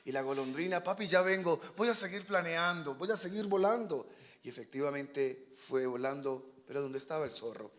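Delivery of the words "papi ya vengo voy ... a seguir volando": put-on higher, sing-song kid excuse; breezy young swallow. Young swallow slipping away from papa, breezy.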